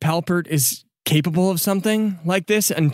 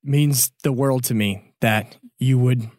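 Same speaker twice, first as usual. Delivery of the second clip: usual speaking voice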